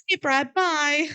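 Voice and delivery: mocking Jamie's hang-up with a high, breezy bye; mock breezy goodbye. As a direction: toss off a fake cheery bye.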